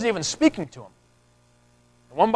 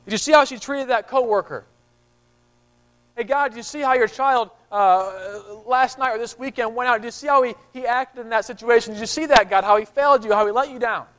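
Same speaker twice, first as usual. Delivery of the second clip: raised, nagging accuser pestering God; accusing Satan; 3 semitones higher than usual. Retold or imitated. Satan accusing a believer before God, nagging and insistent. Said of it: "Satan is saying".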